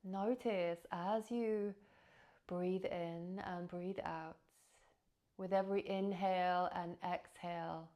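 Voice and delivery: measured, spacious breath-practice narration; guided meditation. Acted. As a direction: guide listeners gently into the breath, leaving space between phrases.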